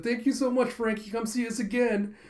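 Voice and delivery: high-pitched, gushing farewell; grateful woman. Retold or imitated. Cat's owner says goodbye to Frankie, gushing thanks.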